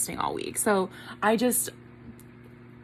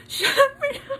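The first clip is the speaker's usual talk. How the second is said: high laughing squeal; excited squeal. Real, not acted. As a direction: squeal with joy. Squealing disbelief.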